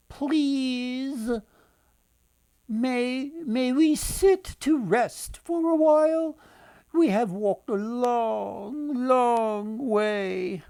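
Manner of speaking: high, slow, pleading travellers; old women's voice. Acted. Tired travelling women ask the girl to rest, high and slow. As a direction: beg to sit like worn-out wanderers.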